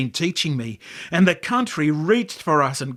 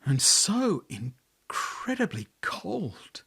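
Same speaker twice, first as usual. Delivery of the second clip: slow, hushed whisper; chilled whisper; 3 semitones higher, 6 dB quieter, slower than usual. A narrator's reading. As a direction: breathe it out slowly, chilled. Narrator's chill at his beauty.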